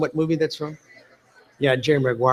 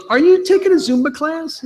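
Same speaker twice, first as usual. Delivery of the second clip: much higher-pitched mock mom voice; imitated mom; 12 semitones higher, 3 dB louder than usual. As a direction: put on a high motherly voice for the question. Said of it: "his mom's turning the page".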